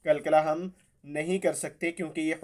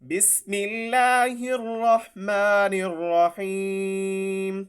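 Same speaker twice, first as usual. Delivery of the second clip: slow melodic tajweed chant, higher; Quran recitation; 3 semitones higher, much slower than usual. Shown as a demonstration. Teacher reciting the surah once through for students.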